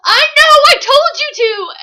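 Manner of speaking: very high, loud outburst; re-enacted indignant shriek; exasperated shout. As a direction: yell an I-told-you-so at the heroine. Exasperated shouting back at heroine.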